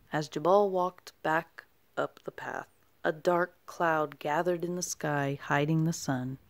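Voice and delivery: ordinary delivery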